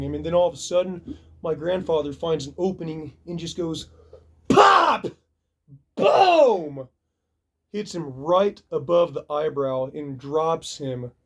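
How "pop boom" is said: much higher, loud punch cries; yelled impact noises; shouted punch sound. Retold or imitated. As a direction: shout the knockout blow. Knockout punch imitated.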